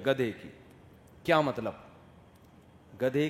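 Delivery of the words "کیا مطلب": ordinary talk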